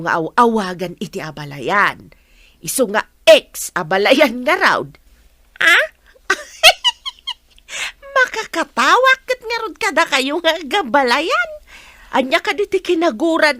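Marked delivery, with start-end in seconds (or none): 6.3-7.3 high, loud burst of laughter; loud high laugh of amusement. Acted. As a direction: crack up at the joke. Laughs at ex abalayan joke.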